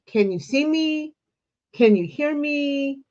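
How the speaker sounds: usual speaking voice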